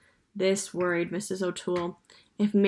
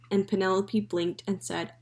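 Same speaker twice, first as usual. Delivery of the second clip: ordinary delivery, nothing marked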